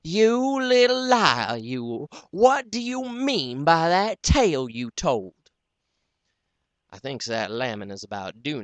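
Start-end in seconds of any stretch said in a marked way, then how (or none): 0.0-5.3 high, loud furious scold; angry scolding. Acted. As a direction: shake the boy with your voice. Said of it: "she grabs me, and she shakes me".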